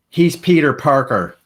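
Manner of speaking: put-on proud child exposing the hero; kid's big reveal. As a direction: blurt it out like a triumphant little kid. Kid reveals identity to everyone.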